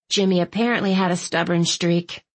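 ordinary delivery, nothing marked

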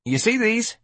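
high, rising street voice; streetwise hustler, pitching. Acted. George shows his packages, street-kid voice. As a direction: pitch it up, salesman's opener.

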